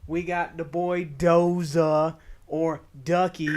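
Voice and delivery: ordinary delivery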